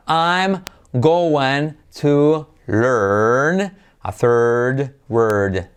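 "I'm going to learn a third word" said very slowly, every sound stretched; very slow demo. Shown as a demonstration. Teacher slowly modelling the rhyming practice sentence. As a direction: draw each word out very slowly. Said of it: "Es como rima".

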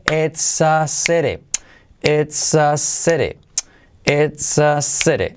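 "it's a city" stretched as "ets aa sere"; slow joined-up drill. Shown as a demonstration. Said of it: "Repetimos todo junto". ("it's a city" todo junto, muy lento)

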